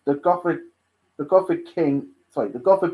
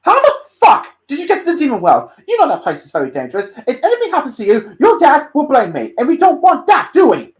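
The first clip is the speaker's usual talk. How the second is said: loud, raised, fast telling-off; upset scolding; 13 semitones higher, 12 dB louder than usual. Acted. Stolas upset at Dante for risking danger, angry. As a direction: scold hard, rushing and getting louder. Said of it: "Stolas looked upset at Dante".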